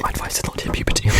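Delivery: normal talking voice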